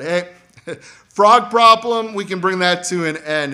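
Moses offering help with a laugh, voice jumping up; breezy, laughing pitch to Pharaoh; eager offer. Acted. Moses to Pharaoh, laughing and eager.

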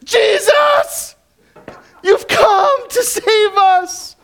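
high, joyful-relief shout to a rescuer; mock cry for a savior; crying out in faith. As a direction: shout it out high and drawn out, hammed up. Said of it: "It was not they cried out".

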